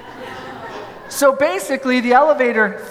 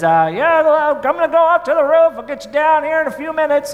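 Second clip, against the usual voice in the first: higher, put-on voice of a workman, quick at the end; technician's voice; 7 semitones higher than usual. Technician shouts through the elevator door, higher put-on workman voice. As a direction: call it through the door like the repairman.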